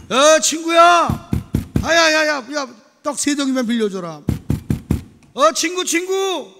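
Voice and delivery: high, loud, drawn-out calling at the door; pleading friend at door. Acted. Friend at midnight begging at the door, loud calling. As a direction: call out to wake the sleeping friend. Acted friend knocking at night.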